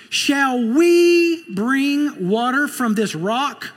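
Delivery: high, loud, slow and haughty, taking credit; mock the crowd as proud Moses; Moses, arrogant. Preacher acting proud Moses before the crowd, arrogant and loud.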